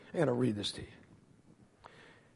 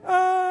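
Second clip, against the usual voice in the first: approaching scream; 10 semitones higher than usual. Retold or imitated. Brother hurtling down the stairs, swelling imitated scream. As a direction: imitate a wail getting closer. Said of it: "I can hear him going".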